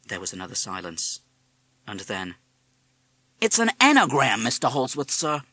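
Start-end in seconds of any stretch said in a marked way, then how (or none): 3.4-5.4 high, loud American parrot; respectful parrot. A narrator's reading. Parrot solving the father's crossword, respectful. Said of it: "in a respectful voice".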